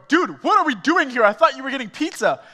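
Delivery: retold angry confrontation; mock outrage. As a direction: confront the friend, incredulous. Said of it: "I was so angry".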